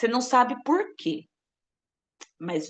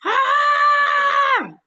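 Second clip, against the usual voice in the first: high, much louder piercing scream; full scream in prayer; 9 dB louder than usual. Shown as a demonstration. Preacher shows what a real prayer scream sounds like. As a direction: throw your whole voice into one long scream. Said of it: "Não é gritar".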